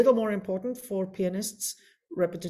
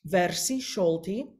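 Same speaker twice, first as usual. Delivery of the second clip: ordinary delivery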